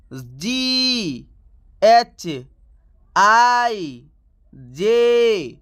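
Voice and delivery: letters drawn out one by one; slow, sustained classroom letter chant; read-along alphabet drill. Shown as a demonstration. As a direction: recite each letter long and held, with pauses for learners to repeat. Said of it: "मैं जिस तरीके से पढ़ूंगा".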